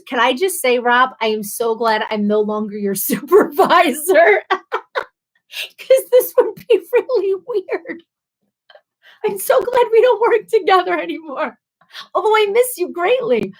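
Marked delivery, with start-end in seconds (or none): 2.9-13.5 laughing. Real, not acted. Still laughing after supervisor joke.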